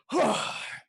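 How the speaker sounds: high, forceful huff; loud relieved exhale. Acted. Orc's jaw pops back into place, loud exhale. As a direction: let out a big loud breath.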